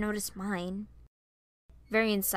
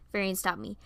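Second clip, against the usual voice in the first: ordinary delivery, nothing marked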